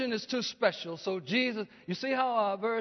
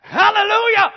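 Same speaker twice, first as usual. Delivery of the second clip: much higher, loud ecstatic cry; hallelujah shout; 10 semitones higher, 14 dB louder than usual. Real, not acted. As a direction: shout it out in joy. Preacher's climactic exclamation.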